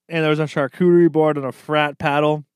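ordinary talk, nothing marked

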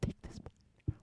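breathy hushed menace; whispered threat. Retold or imitated.

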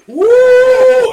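very high, loud jeering whoop; sarcastic whoop. Retold or imitated. Coworker goading Kevin, imitated sarcastic whoop. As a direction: whoop long and loud to annoy. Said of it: "basically what it is is sarcastic".

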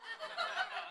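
high laugh. Real, not acted. Laughs at own finger trick.

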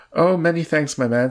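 lofty, airy gentleman; most airy. A narrator's reading. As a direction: toss off the thanks with airy grandeur. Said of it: "in a most airy voice".

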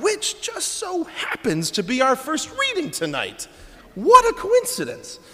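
very high-pitched feigned surprise; mock amazement. Feigns amazement at the planned reading.

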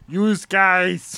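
attempted coach accent; lightly voiced big coach saying "Use guys". Game master practicing the guard, coach accent. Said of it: "trying to sound like a big coach".

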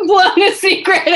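giggling through the words; laughing. Real, not acted. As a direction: laugh while confessing the slip. Laughing at spilled secret.

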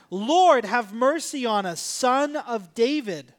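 a loud first word, then drawn-out pleading call; retold crying out. Blind men by the road calling to Jesus, retold crying out. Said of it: "cried out".

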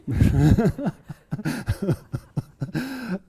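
loud, high burst of laughter; a real, ringing laugh; hearty laugh. Real, not acted. Laughs at the memory.

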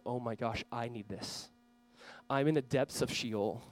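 quiet despair. Acted. Jonah's despair voiced quietly.

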